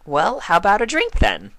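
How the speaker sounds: very high, bubbly pony voice; Pinkie Pie voice. A narrator's reading. Pinkie offering Spike a drink, high and bubbly. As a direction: offer it with glee.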